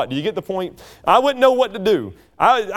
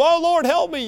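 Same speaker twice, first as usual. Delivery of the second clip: high-pitched mock cry for help; acted desperate plea; 9 semitones higher than usual. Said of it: "can't get our breath and don't know what's going".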